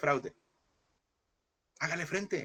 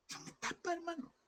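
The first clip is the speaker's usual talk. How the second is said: much softer, breathy hush; whispered; 13 dB quieter than usual. Real, not acted. Preacher confiding to his brothers in a whisper. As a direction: lean in and whisper it. Sudden hushed aside to congregation.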